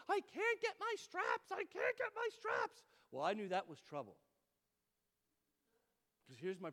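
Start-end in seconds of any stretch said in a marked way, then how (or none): 0.1-2.8 put-on high, loud, drawn-out child wail; panicking little girl. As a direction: imitate a toddler wailing for help. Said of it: "I have a kid".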